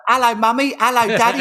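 put-on high-pitched little Cockney kid; exaggerated high Cockney kid voice; Cockney child. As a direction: pipe up as a small Cockney child. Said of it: "I had a Cockney accent".

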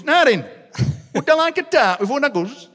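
high, loud put-on uncle voice; a cheeky uncle setting up his prank; joker uncle. Uncle offering dad a fake tart, high and cheeky.

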